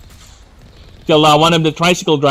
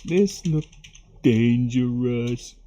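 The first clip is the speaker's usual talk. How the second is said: softer, very slow half-voiced cartoon line; quiet SpongeBob quote; 4 semitones lower, 6 dB quieter, much slower than usual. Streamer softly repeating the SpongeBob line, very slow. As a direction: mumble the cartoon line to yourself, slowly. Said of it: "Remember this one?".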